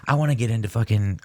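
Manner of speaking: ordinary talk, nothing marked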